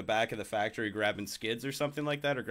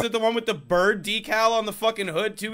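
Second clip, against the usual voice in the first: raised, louder, eager retold question; excited question; 11 semitones higher, 6 dB louder than usual. Worker asking his buddy about the car, excited. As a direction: ask eagerly, riding high on the discovery.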